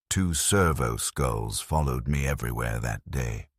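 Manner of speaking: ordinary delivery, nothing marked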